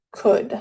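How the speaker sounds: "could" with no L sound at all; silent L in could. Shown as a demonstration. Teacher models the word for Spanish-speaking learners, silent L in "could". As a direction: say "could" as if spelled C-O-U-D. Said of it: "que solamente sería C O U D".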